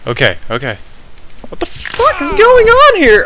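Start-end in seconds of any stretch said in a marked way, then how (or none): 1.5-3.2 loud, very high startled outburst; alarmed disbelief. Real, not acted. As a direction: shoot up high in real alarm. Startled by new game hazard.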